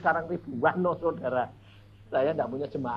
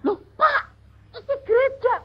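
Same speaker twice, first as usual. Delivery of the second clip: high, loud pleading; alarmed pastor; 8 dB louder than usual. Retold or imitated. Pastor panicking at the preacher before the service, high alarmed plea. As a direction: sound panicked that the speaker has nothing ready.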